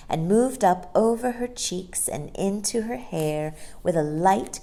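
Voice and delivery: plain reading voice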